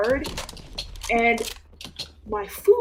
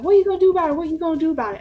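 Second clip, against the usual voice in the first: higher, louder taunt; taunting girl; 6 semitones higher, 5 dB louder, much faster than usual. Retold or imitated. Lunchroom girl taunting narrator, mocking challenge. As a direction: challenge her to act. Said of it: "she's like".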